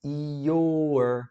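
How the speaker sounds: slow "your" with all three sounds stretched; teacher stretching "your" to show I, O and R; drawn out. Shown as a demonstration. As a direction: pull "your" out long and deliberate. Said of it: "eu gosto de puxar assim".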